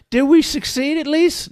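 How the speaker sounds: high-pitched hopeful question; hopeful returned soul. Acted. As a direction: ask it high and anxious. Returned Matt asks hopefully.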